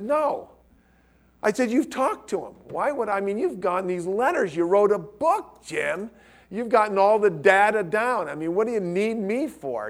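retold protest, pitched well up; emphatic refusal. [name] protesting to Jim that he is not needed, high-pitched retold refusal. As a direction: push back hard at the idea.

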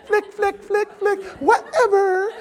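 very high ditzy valley voice; Valley Girl. Retold or imitated. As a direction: flick the hair, airhead. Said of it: "blonde girl".

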